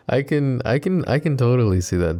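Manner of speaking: high, chuckling start; amused laughter. Acted. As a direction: laugh your way into the words, teasing lightly. Amused by listener's goth school past.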